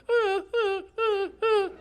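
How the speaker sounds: high-pitched puppy whimpers; imitated dog whimper. Dog asking owner to play, high imitated whimpering. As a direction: whimper in short, high little yelps. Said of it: "and she'll whimper".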